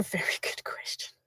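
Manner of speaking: breathy giggling whisper; laughing whisper. Real, not acted. Answering viewers' money question, whispered laugh.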